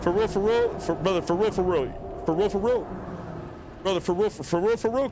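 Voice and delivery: high, drawled for real, for real; repeated high bro plea to the enemy player; pleading bro voice. Acted. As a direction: beg him like a buddy who swears it.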